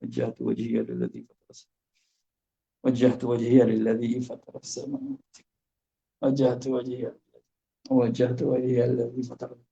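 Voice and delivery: a series of careless prayer readings; various careless styles. Shown as a demonstration. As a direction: try different sloppy ways of reciting. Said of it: "Macam-macam".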